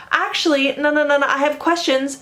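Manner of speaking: high-pitched keen classmate interrupting; mock the pupil who always has questions; eager student.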